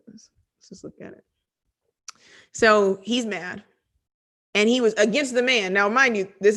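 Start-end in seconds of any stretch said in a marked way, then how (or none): 0.1-1.2 breathy whisper aside; a hushed aside to the congregation. Real, not acted. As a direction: murmur it to yourself. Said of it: "let's look at what he says".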